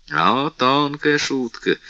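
ordinary talk, nothing marked

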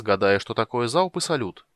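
ordinary delivery

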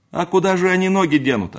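high, loud objection; indignant, high-pitched retort; objecting servant. Acted. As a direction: protest loudly over the packing. Servant objects while loading carriage.

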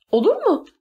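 very high, pressing repeat; insistent question. A narrator's reading. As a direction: demand an answer, pitched sharply up. Girl presses for an answer.

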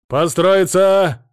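very slow, loud drawn-out order; sergeant's command. Acted. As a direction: bellow the command across the crowd. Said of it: "раздался голос расталкивающего толпу фельдфебеля". (зычная команда фельдфебеля)

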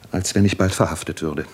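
usual speaking voice

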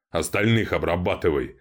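usual speaking voice

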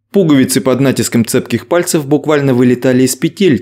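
ordinary delivery

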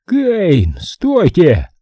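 hissed over the radio. Acted. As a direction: hiss the warning down the line. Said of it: "прошипело радио прямо мне в ухо".

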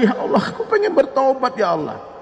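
high wailing prayer, dropping at the end; mock tearful plea. Preacher acting the weeping sinner begging to repent, tearful. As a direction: imitate a man crying out to God. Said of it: "Dia menangis lagi".

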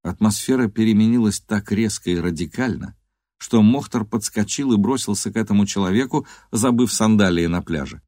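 ordinary delivery

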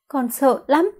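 put-on high, small frightened child; bé gái run sợ. A narrator's reading. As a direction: confess fear in a weak little-girl voice. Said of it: "Giọng nói khàn khàn yếu ớt có chút run rẩy". (giọng bé gái yếu ớt, sợ hãi)

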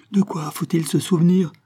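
high-pitched frantic demand; yelling. A narrator's reading. Malouin to the dying man who holds the secret, yelling. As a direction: yell it in desperation. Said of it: "Il hurla".

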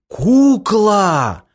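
high, joyful exclamation; delighted. Acted. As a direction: burst out with joy. Girl realizes it is a doll.

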